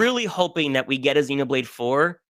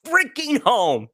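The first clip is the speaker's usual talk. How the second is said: high, drawn-out cry of elation; overjoyed exclamation; 5 semitones higher, slower than usual. Retold or imitated. Fan reliving the sight of Colony nine, elated. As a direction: act out a thrilled homecoming, pitch soaring. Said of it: "and I'm like".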